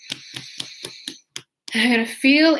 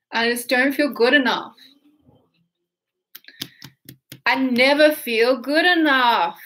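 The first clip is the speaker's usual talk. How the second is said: overplayed despair, slow and building louder; exaggerated feeling; 3 semitones higher, 4 dB louder than usual. Shown as a demonstration. Tapping coach showing viewers how to exaggerate, overdone despair. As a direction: ham up the belief on purpose. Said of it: "And see how I'm doing? ... over-exaggerating".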